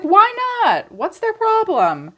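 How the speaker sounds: high-pitched incredulous squeak, slowing into "problem"; mock exasperated. Reader mocking the stalled person, in a high exasperated squeak. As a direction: squeak it out in disbelief. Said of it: "I was like".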